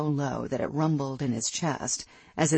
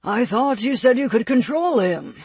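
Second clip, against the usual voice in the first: raised, pointed accusation; high, loud and edgy; edge in the voice; 9 semitones higher, 7 dB louder than usual. A narrator's reading. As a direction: challenge them with an edge in your voice. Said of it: "an edge in his voice".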